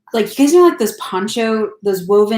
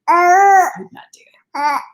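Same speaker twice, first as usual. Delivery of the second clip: higher, louder, slow exclamation; emphatic; 10 semitones higher, 5 dB louder, slower than usual. Real, not acted. Vlogger telling Floridians she couldn't handle it, loud slow exclaim. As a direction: drag it out in disbelief.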